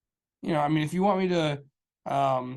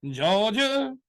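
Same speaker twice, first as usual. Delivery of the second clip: "Georgia" sung out higher and louder, teasing; playful higher "Georgia"; mock drawn-out Georgia; 7 semitones higher, 4 dB louder than usual. Real, not acted. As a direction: stretch "Georgia" like a mocking announcer. Repeats the place name, mocking.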